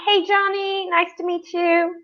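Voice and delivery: normal talking voice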